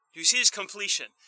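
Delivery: a brisk restatement of "completion". Shown as a demonstration. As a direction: rush the question through a second time. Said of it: "repeated question".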